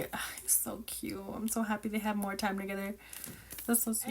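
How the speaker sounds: soft unvoiced swooning whisper; gushing whisper. Real, not acted. Whispering to viewers about the sweet grandpa, swooning. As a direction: whisper it like you're melting.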